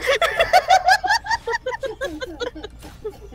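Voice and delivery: long high-pitched burst of laughter; loud laughing fit. Real, not acted. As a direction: crack up helplessly at your own story. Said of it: "the laughing is too loud".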